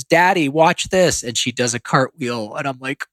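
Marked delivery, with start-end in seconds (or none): 0.0-1.2 lightly voiced child, higher and slower; a hint of an excited child; kid's voice. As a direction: call out like a kid showing off. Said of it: "the kid in the background says".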